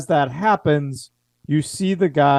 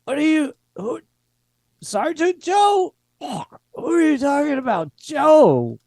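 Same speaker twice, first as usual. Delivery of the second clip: much higher, baffled voice; confused soldier; 13 semitones higher than usual. Acted. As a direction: ask it like you've never heard the name.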